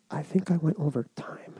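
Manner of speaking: soft unvoiced aside to the room; whispered aside. Real, not acted. Aside at end of talk.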